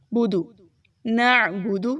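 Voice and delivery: بودو without stretch; short bu. Shown as a demonstration. Teacher showing short vowels. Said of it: "بودو کو کھینچیے مت".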